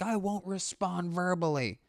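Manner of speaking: high, soft, smart-aleck reply; snarky heckler. Retold or imitated. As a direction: mouth off sarcastically. Heckler answers back mockingly.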